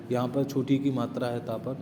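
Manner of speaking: ordinary delivery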